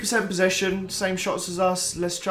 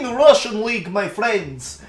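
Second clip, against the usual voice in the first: put-on a quick, half-joking stab at a Russian accent, higher and louder; mock Russian accent; 3 semitones higher, 4 dB louder than usual. YouTuber hosting a Russian league save, mock Russian accent. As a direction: slip into a put-on Russian accent for two words. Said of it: "I don't even know what that was".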